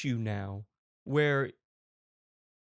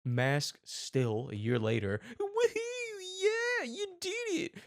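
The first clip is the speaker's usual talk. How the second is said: very high squealing cheer; mock cheering teachers; slower than usual. Mocking teachers at the graduation, in a high squeal. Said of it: "they were like".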